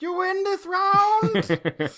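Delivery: high, slow, loud shaking-fist yell; sputtering foiled villain. Retold or imitated. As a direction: shout like a beaten cartoon villain. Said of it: "I just shake a fist".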